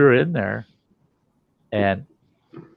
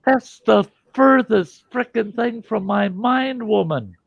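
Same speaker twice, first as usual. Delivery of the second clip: exasperated retort; 12 semitones higher, 3 dB louder than usual. Retold or imitated. His indignant reply to wife.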